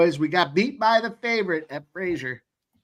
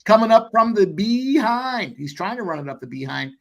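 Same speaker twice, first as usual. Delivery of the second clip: excited race-calling patter; 3 semitones lower than usual. Acted. Host calling the race for stream viewers, excited. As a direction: call the horses like a track announcer. Calls horse race live.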